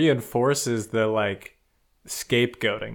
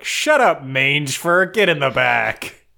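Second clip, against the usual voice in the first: loud, higher mock-bully snap; bullying bark; 4 semitones higher, 6 dB louder, slower than usual. Horde rat orders new recruit to the back, mock bullying bark. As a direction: shove the new guy around.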